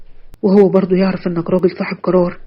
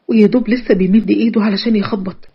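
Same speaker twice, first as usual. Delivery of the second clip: ordinary delivery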